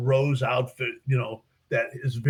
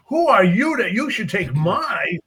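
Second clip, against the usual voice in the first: higher, louder affronted challenge; indignant priest; 7 semitones higher, 6 dB louder than usual. Retold or imitated. Priest objects to the stranger taking his parishioner, affronted. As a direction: claim the flock as yours.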